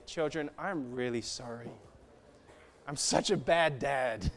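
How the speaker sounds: soft, low, slow apology; apologetic to children. Retold or imitated. Father apologising to his children, soft and low. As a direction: say sorry gently and quietly. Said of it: "I have to say".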